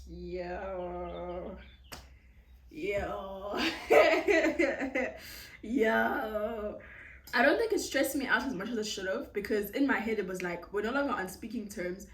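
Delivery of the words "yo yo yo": yo dissolving into laughter; cracking up in shock; laughing disbelief. Real, not acted. Panicked reaction.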